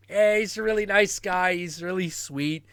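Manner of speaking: high, sweet, dopey voice; high-pitched bumbling nice guy; bumbling bailiff. Acted. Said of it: "where he plays this bumbling bailiff".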